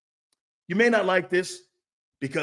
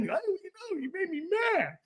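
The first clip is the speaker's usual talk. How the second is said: higher, softer mocking whine; mock whiny crybaby; 10 semitones higher, 6 dB quieter, faster than usual. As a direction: imitate a sulking teammate. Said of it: "like a bib and start moaning".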